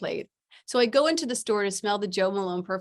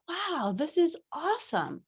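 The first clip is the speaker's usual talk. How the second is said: thrilled wow; 8 semitones higher than usual. Retold or imitated. Invited to speak at conference.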